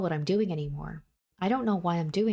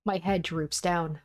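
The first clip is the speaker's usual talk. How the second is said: ordinary delivery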